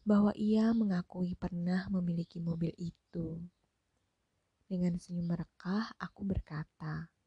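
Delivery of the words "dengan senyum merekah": plain reading voice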